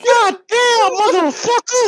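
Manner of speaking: high, loud squawk of outrage; mock outraged yell. Security guard reacting to a knocked-over glass, mock outraged yell. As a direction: blurt it high and loud, exasperated.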